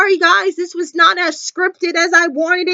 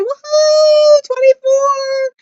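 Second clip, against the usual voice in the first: very high, loud drawn-out whoop; whooping cheer; 9 semitones higher, 4 dB louder, much slower than usual. Real, not acted. Vlogger celebrating Adrian's twenty-fourth birthday, whooping cheer.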